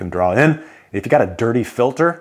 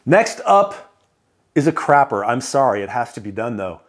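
ordinary delivery

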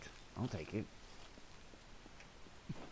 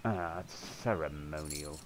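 ordinary delivery

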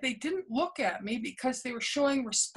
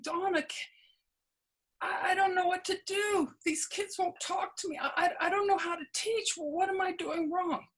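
higher-pitched, at-a-loss retold plea; frustrated venting; 7 semitones higher than usual. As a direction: retell venting helplessly, voice lifted. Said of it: "saying all the things that were frustrating".

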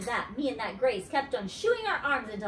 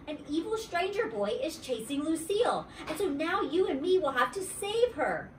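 higher-pitched, mildly urgent call; rally a friend with raised pitch; shouting back; 7 semitones higher than usual. A narrator's reading. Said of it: "I shouted back".

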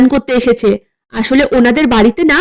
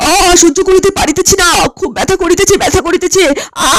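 higher, pained groaning words; moaning in pain; 4 semitones higher than usual. Acted. Guest moaning to his helper about the pain. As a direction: whimper it through the hurt.

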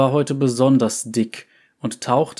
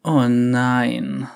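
dismay. A narrator's reading. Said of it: "sagte Vex mit Bestürzung".

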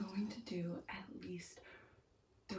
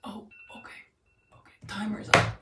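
sharp cold gasp; 12 dB louder than usual. Real, not acted. In an ice bath.

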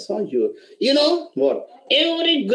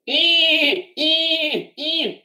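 short bleating eh sounds; goat bleat; 4 semitones higher, much slower than usual. Pretend. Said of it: "Can you make a sound of a goat?".

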